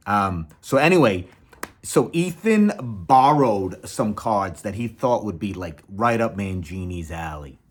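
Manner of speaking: ordinary delivery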